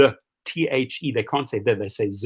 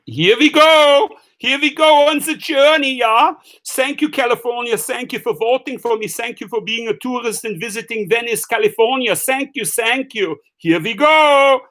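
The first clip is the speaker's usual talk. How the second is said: a hammed-up victory cry as the car pulls away; mock cheering; 8 semitones higher, 3 dB louder than usual. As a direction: cheer like you just won an election. Driving off with tourists, cheering.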